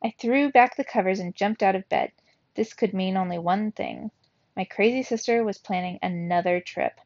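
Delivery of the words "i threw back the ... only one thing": ordinary delivery, nothing marked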